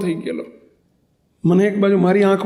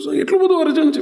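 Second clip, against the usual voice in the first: much higher, taken-aback question; astonished young man; 10 semitones higher, much faster than usual. Retold or imitated. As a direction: sound caught off guard by the urgency. Young man surprised on phone.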